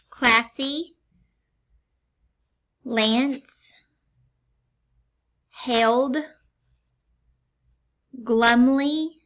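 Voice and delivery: crisp, deliberate dictation of each word; over enunciating. Shown as a demonstration. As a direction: enunciate every sound clearly for a test. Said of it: "but I'm gonna enunciate very clearly".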